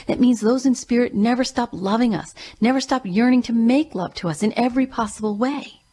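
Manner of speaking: ordinary talk, nothing marked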